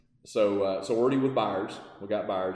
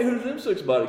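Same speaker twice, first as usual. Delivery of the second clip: high, giggling imitation; mock puzzled trucker, laughing; laughing mock question; 10 semitones higher than usual. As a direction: laugh through the quoted question. Mimicking puzzled freight workers.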